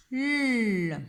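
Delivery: "l" as a long, loud lll; isolated sound. Shown as a demonstration. Teacher isolates first sound, "l" held long. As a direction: hold "l" without its letter name.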